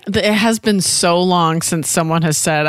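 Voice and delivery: usual speaking voice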